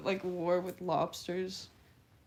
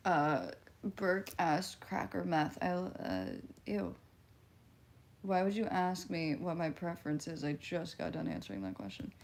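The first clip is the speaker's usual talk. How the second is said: ordinary talk, nothing marked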